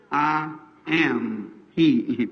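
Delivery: soft, slow and low; hushed slow reveal; quiet reveal. Acted. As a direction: say it gently and slowly. Jesus reveals himself quietly.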